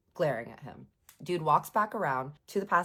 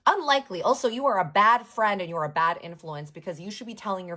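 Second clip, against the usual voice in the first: loud, higher-pitched mock scolding; retold telling-off; 3 semitones higher, 6 dB louder than usual. Woman scolds a bad friend, retold telling-off. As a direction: tell him off forcefully, as retold.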